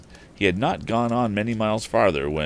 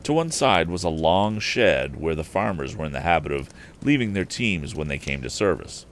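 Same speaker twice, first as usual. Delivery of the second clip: usual speaking voice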